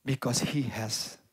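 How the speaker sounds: low, hushed and slow, as if moved to tears; quiet, emotional retold plea; tearful plea. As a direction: softly voice a weeping man's halting request. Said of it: "mencucurkan air mata".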